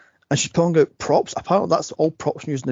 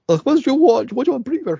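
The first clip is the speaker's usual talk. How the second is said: high, loud mimicked snap at a caller; high-pitched mock exasperation; irritated phone answer; 10 semitones higher, 5 dB louder than usual. Voicing film character answering the phone.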